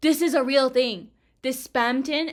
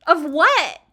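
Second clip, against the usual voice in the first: loud, higher, incredulous questions; excited demand; 8 semitones higher, 10 dB louder than usual. Real, not acted. Streamer demanding who the game hints at, excited. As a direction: demand the answer loudly, pitch climbing. Demands answer about hinted character.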